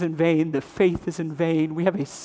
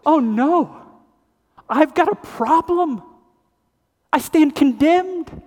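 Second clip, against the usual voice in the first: dismayed realization; 8 semitones higher, 3 dB louder, slower than usual. Retold or imitated. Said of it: "we all of a sudden go".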